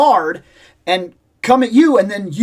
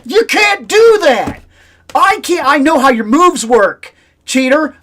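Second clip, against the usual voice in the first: indignant protest; 4 semitones higher, 5 dB louder than usual. Retold or imitated. Player yelling at Guile in the game, indignant protest. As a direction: yell in exasperation at a cheating opponent.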